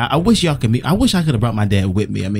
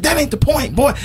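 high-pitched, impatient mock yell; imitated yelling dad; 11 semitones higher than usual. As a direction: dismiss the objection like a hollering dad. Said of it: "He just kept yelling".

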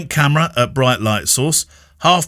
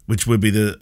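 ordinary talk

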